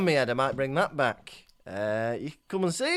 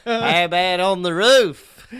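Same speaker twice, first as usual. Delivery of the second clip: slower mock Australian accent, a bit louder; quoting the tweet in a playful Australian accent; 2 semitones higher, 9 dB louder than usual. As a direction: relish the line in a hammed-up Australian accent. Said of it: "his Australian accent".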